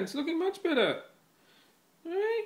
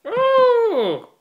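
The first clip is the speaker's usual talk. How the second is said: sudden high loud cry; excited yelp; 7 semitones higher, 9 dB louder than usual. Real, not acted. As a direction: burst out with delight. Excited yelp at cat's leap.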